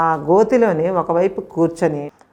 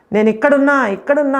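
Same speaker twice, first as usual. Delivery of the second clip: higher, louder cry from the pit; shouting for help; 6 semitones higher, 3 dB louder than usual. Retold or imitated. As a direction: yell up out of the well to be found. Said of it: "గట్టిగా అరుస్తున్నాను నేను". (బావిలోంచి సాయం కోసం గట్టిగా అరుపు)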